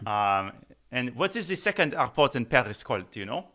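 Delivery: mock French accent, playful. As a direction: put on a joking French accent for the place names. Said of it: "but in a French accent".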